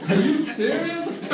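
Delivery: high, laughing, incredulous; laughing disbelief. Retold or imitated. Speaker learning his partner can't find the car battery, laughing in disbelief.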